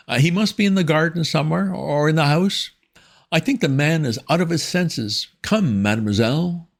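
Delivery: high, prim schoolmistress tone; stern headmistress voice. A narrator's reading. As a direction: speak down to him like a severe headmistress. Miss Tomlinson voiced, hurried.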